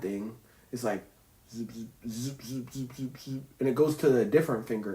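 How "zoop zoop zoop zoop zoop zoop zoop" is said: soft quick zoop zoop sounds; zapping noises. Retold or imitated. Explaining the brain to viewers, soft zap noises. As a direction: imitate little neuron zaps. Imitating neuron zaps.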